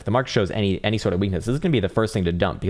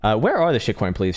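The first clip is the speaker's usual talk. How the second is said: usual speaking voice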